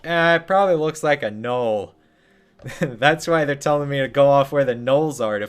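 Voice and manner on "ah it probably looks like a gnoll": dumb dwarf voice. Retold or imitated. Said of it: "they're just like".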